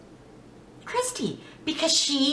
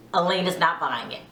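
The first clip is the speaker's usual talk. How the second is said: normal talking voice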